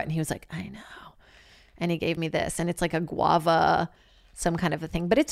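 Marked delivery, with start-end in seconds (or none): 0.5-1.1 breathy defeated whisper. Retold or imitated. Barista quietly agreeing with the customer, whispered. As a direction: whisper it, worn out. Barista answering weary Karen.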